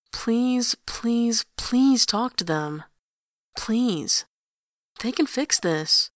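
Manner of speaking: slow, earnest begging; pleading. A narrator's reading. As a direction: beg her, one please at a time. Grantaire pleading.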